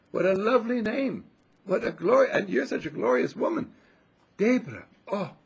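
high, loud gushing praise; heartfelt admiration. Retold or imitated. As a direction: imitate an effusive admirer. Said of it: "And I mean it".

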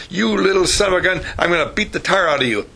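lightly acted scolding mother; acted angry scolding. Mother to her shouting little boy, mildly acted anger.

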